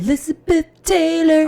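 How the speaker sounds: loud, high burst of excitement; excited. Real, not acted. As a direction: shout the title like a fan. Excitedly naming favourite song.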